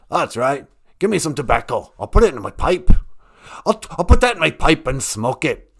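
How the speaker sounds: put-on pipe-loving spirit with an odd accent; Great Spirit character. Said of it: "accent is from".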